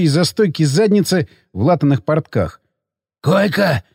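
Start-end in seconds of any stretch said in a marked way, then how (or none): 3.2-3.8 lightly voiced croak from below; a touch of a wheezy character voice; hoarse croak. A narrator's reading. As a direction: croak out one curt word. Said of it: "прохрипело снизу".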